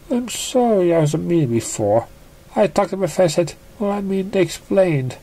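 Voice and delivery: high, hesitant apologetic pony voice; shy pony Piggy. Acted. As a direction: fumble the apology, a little flustered.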